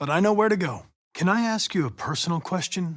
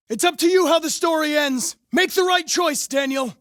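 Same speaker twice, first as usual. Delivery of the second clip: high, loud negotiating plea; urging the deviant loudly; urgent shout; 9 semitones higher, 4 dB louder than usual. Acted. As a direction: shout over the rooftop to him. Negotiating with a hostage taker.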